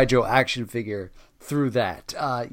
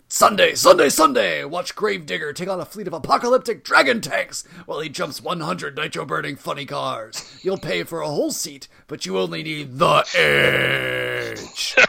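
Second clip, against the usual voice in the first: monster truck ad; 3 semitones higher than usual. Pretend. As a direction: roar it like a monster truck rally promo. Monster truck rally ad parody.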